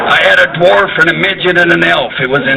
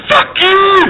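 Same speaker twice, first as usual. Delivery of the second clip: high, yelled defiance; battle cry. Acted. Scots in kilts going to battle, high yell.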